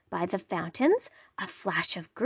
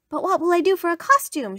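high, rushed, eager iguana; excited cry; 8 semitones higher, 3 dB louder, faster than usual. A narrator's reading. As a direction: rush it out, high and worked up. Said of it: "she cried".

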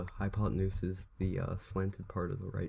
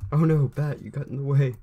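sudden loud high cry of dismay; alarmed outcry; 6 semitones higher, 11 dB louder than usual. Real, not acted. Player shouts at the bat he just hit, alarmed. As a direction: yelp it out, caught off guard. Accidentally hits pet bat.

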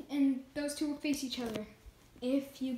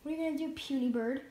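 ordinary delivery

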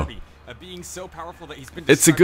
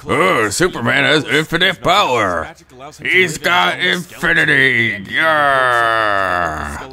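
slow, higher mocking imitation; 5 semitones higher, much slower than usual. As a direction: parrot the fans in a silly drawl. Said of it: "is like".

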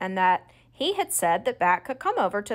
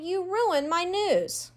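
whine; 10 semitones higher, slower than usual. A narrator's reading. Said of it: "Janie whined".

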